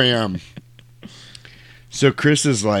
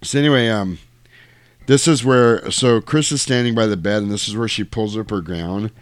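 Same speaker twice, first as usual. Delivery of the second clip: ordinary talk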